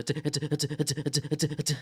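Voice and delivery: rapid clicking t-sounds drawn inward; vocalized inward drag. Shown as a demonstration. Beatboxer demonstrates his inward drag sound to the host. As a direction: demo the inward drag, voiced and rhythmic. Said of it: "the vocalized inward drag".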